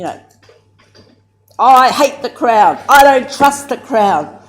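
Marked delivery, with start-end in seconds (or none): 1.6-4.4 higher and louder, hammered home; loud defiance. Retold or imitated. Aunties at the second hapu meeting, loud and defiant. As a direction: insist on it, every word pushed out loud.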